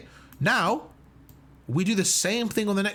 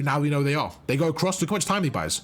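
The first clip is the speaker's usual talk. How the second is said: ordinary delivery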